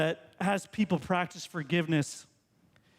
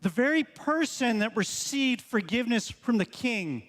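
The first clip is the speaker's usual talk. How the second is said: ordinary talk, nothing marked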